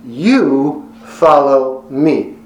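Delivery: very slow, each word hammered, YOU first; "You follow me" drawn out with heavy stress on "You"; emphatic command. Shown as a demonstration. Preacher driving the command home to all, very slow and emphatic. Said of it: "here's the emphatic command".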